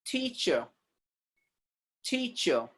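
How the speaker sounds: teacher as "TEA-chuh", final R dropped; softly said teacher with no R; British silent R. Shown as a demonstration. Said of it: "they keep that R silent".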